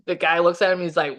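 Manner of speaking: ordinary delivery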